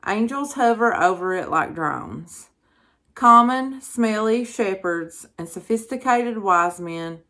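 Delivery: measured, emphatic reading with firm phrase starts; projected inspirational reading; devotional read-aloud. Acted. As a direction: read a Christmas devotional out to an audience.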